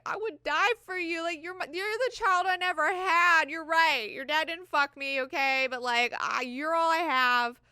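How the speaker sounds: high, loud hammed-up mom begging; mock tearful pleading. Irulan in mom mode begs Ghanima, mock tearful. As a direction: plead like an overwrought mother, easing off at the end.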